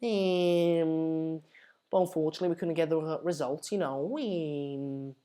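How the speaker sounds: hesitant Scouse, fillers stretched right out; Wayne Rooney. Acted. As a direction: do a mumbling Scouse post-match interview. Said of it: "that's Wayne Rooney".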